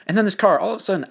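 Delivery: ordinary talk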